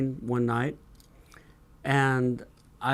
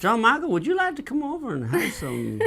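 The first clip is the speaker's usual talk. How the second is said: a hint of a Southern woman inviting; Southern belle invitation; 9 semitones higher than usual. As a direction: coo it like a Southern hostess. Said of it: "the way the women say that".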